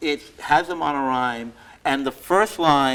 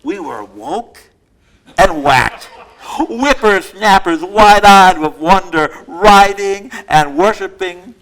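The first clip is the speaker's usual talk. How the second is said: bold, punchy alliterative recital, louder; poem reading; 4 semitones higher, 10 dB louder, slower than usual. Acted. Poet reciting her Worcester poem to the audience, emphatic and loud. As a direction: hammer out every W like a performance poet.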